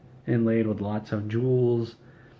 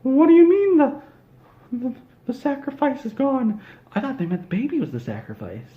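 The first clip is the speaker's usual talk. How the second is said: very high, loud squeaky cartoon mouse; villainous falsetto Mickey proclamation, loud and gleeful; 5 dB louder than usual. Mickey announces raising Walt Disney, in a loud high squeaky voice. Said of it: "And Mickey Mouse says".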